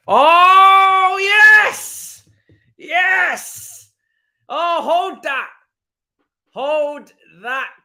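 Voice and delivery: high, loud cry of joy; jubilant yell. Real, not acted. Fan celebrating the disallowed goal with loud jubilant cries. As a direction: scream with delight at the news.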